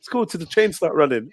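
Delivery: normal talking voice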